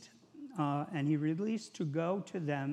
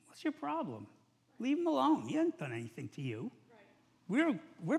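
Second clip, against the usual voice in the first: high, indignant rebuke; defending thief; 8 semitones higher than usual. Acted. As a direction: snap back in defense of Jesus. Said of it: "guy said".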